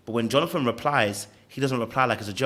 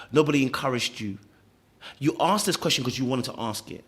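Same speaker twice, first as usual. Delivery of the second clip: usual speaking voice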